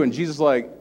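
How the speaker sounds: ordinary delivery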